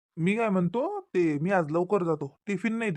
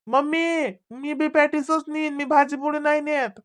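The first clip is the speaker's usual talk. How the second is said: high, raised-voice complaint; shouted protest; 7 semitones higher, 4 dB louder than usual. Acted. Child demanding patties from mother, shouted protest. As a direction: protest loudly, pitched right up. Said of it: "ए, ओरडू नका".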